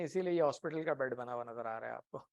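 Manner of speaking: ordinary talk, nothing marked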